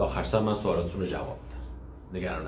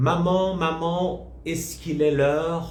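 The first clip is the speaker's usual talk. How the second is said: put-on child voice; 8 semitones higher, much slower than usual. Teacher voices Caillou asking mother.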